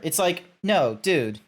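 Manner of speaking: ordinary talk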